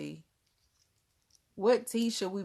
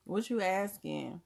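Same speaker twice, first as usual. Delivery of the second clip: ordinary talk, nothing marked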